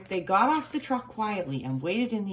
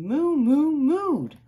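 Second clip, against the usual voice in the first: high, loud mooing like a cow; 8 semitones higher, 6 dB louder, much slower than usual. A narrator's reading. Narrator voicing cows mooing with excitement.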